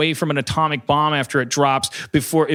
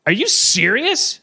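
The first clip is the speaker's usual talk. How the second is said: incredulous friends; 8 semitones higher, slower than usual. Retold or imitated. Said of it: "they were like".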